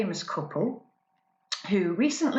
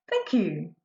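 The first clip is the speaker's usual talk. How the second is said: usual speaking voice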